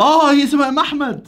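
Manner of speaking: loud, high burst of realisation; excited exclaim. Real, not acted. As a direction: shout it as it clicks. Realising the trick in the riddle.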